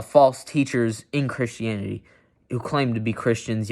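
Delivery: ordinary talk, nothing marked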